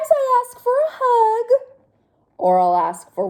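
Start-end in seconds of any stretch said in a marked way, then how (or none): 0.1-1.5 high, loud pigeon voice; sweetly asking. Acted. Pigeon listing what it asks for, high and loud.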